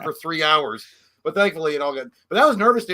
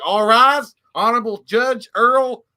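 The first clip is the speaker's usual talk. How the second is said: loud, high, drawn-out announcement; courtroom bailiff; 6 semitones higher, 7 dB louder, much slower than usual. Retold or imitated. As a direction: imitate a bailiff calling the court to order. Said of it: "the guy was like".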